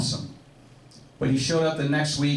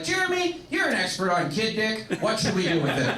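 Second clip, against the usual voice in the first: high-pitched, naive asking voice that settles down by the end; mock asking friend; 7 semitones higher than usual. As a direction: start very high like a clueless friend asking, then come down. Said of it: "it kinda sounds like".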